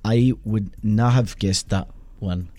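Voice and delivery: put-on Scottish accent, slowing down; imitated Scottish brogue. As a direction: attempt a Scottish accent, spacing out the words. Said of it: "That accent was Scottish".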